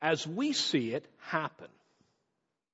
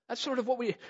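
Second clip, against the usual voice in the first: ordinary delivery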